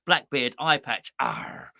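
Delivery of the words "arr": low pirate growl. Shown as a demonstration. Host lists traditional pirate trappings, pirate growl. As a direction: growl a long, deep "arr". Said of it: "I'm talking in the traditional sense".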